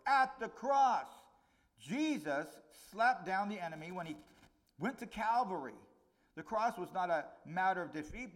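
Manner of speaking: high, loud, slowed preaching climax; fiery preaching. Acted. Preacher rousing the congregation about the cross, full-force preaching. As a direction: proclaim the victory, pounding each phrase.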